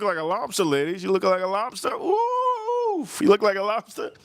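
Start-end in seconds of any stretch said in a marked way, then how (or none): 1.9-3.1 high squealed ooh; teasing ooh. Acted. Teasing a lobster-red lady, high squealed ooh. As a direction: squeal it like a tease.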